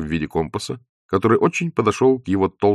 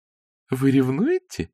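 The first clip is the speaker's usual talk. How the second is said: slow, teasing question; sly; 6 semitones higher, slower than usual. A narrator's reading. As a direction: tease him slyly. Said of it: "лукаво спросила Зося".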